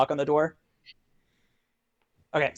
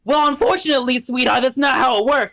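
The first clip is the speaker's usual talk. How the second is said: higher-pitched, wisecracking imp; 4 semitones higher than usual. Acted. Imp answering the one who opened the box, dismissive. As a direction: let the little imp brush them off. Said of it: "and the imp looks up at you".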